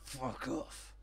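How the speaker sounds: low hushed curse; grumbled. Retold or imitated. Ron dismissing his neighbour in a low grumble. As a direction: mutter it darkly. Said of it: "occasionally he's like".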